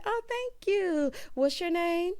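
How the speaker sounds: high, drawn-out mock-sweet thanks; imitated coy bride. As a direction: play the flattered bride up high. Voicing the bride's coy reply.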